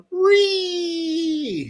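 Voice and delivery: a sudden squealing "Ree!" cry; shrill internet screech; high mock shriek. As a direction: shriek it like a triggered meme. Mock shriek after salty patriots.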